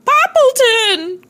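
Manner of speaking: high, slow shocked cry of the name; cried out. A narrator's reading. Neighbor soaked by the hose, crying out in a high shocked wail. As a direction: wail the name as the water hits. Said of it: "cried Cherry Sue, dripping".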